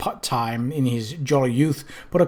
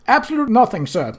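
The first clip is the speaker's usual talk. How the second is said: prompt reply; 7 semitones higher than usual. A narrator's reading. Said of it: "said Rincewind promptly".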